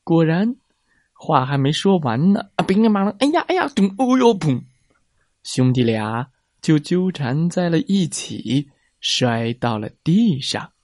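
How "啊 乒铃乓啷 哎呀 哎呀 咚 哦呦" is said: high yelps and crashing noises. A narrator's reading. Brothers crashing to the ground, yelping high. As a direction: play the tumble with cries.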